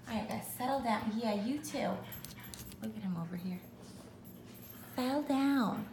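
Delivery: soft gentle settle-down voice; soothing the dogs. Real, not acted. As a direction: owner softly settling her dogs.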